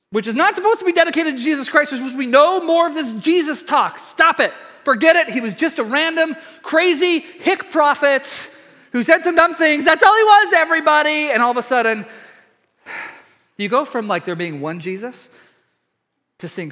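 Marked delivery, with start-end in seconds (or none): 0.1-11.3 raised, pushed mock-ranting authorities; hammed-up outraged decree; mock official outrage. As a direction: rant like indignant officials, louder and higher. Mock authorities shouting down Jesus talk.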